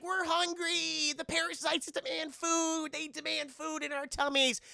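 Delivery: high, slow mock-child whine; hammed-up drawn-out kiddie voice; whining kids. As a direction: chant it like demanding hungry children. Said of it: "the kids are like".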